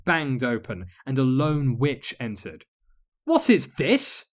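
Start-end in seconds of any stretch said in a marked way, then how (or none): 3.2-4.2 high, loud, indignant queen; demanding. Acted. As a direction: demand an answer from the intruder. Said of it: "she demanded".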